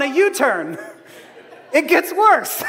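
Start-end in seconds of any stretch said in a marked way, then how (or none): 1.7-2.6 mock alarmed exclaim. Real, not acted. Joking punchline about Peter.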